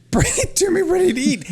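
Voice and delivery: high, put-on whine demanding service; mock whiny customer. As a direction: whine it like an entitled diner. Mocking a lazy restaurant's demand.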